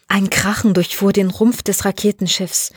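ordinary delivery, nothing marked

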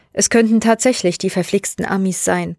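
usual speaking voice

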